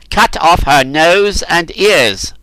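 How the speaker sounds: slow, loud command; stern order. A narrator's reading. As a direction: order it coldly from the dais. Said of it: "ordered Cernus".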